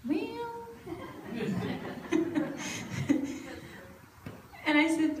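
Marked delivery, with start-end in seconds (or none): 0.1-0.7 a high, hushed, grudging well; reluctant hesitation. Retold or imitated. Reluctant answer to God. 0.9-3.6 a soft, airy, unvoiced chuckle; breathy laugh. Real, not acted. Laughs at own reluctance.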